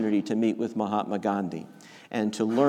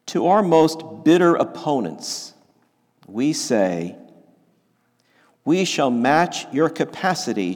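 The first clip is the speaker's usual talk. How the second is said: slow solemn reading; 5 dB louder than usual. Acted. Preacher reading King's words to the congregation, slow and solemn. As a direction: read it slowly, rising with force on each vow. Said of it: "I'll read it slowly".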